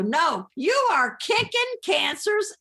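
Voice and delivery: high, slow, emphatic cheer; rallying cry. Retold or imitated. Friends phone to spur her on, high drawn-out rallying cry. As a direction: draw each word out high, like a pep talk.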